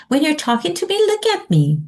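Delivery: put-on high-pitched talk to a child; adult instructing children. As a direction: pitch climbing, prompt a child for attention. Voices an adult addressing children.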